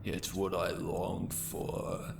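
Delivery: slow, breathy, menacing hush; sinister whisper. Acted. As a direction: savor every word like a sadist. Cult leader savoring victim's screams.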